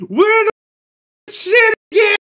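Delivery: mock innocent. Kid voice to grandpa.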